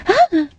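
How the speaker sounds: sharp high startled cry; startled gasp. Acted. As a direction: jump at the sudden voice. Startled by Lillica.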